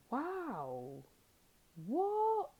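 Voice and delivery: mock shocked wow, then a high what; perplexed. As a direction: play stunned disbelief.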